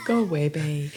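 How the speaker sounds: gentle stage Irish; quiet mock Irish. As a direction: murmur Galway Bay like a local. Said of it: "There's an accent coming".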